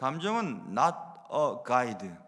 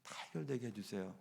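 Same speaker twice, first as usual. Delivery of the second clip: "다 해결되게 해주세요" repeated much softer and lower, dying away; softer repeated plea, trailing off; fading prayer; 8 semitones lower, 13 dB quieter than usual. Retold or imitated. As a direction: let "다 해결되게 해주세요" fade almost to a hush. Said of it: "하나님 앞에 많이 기도합니다".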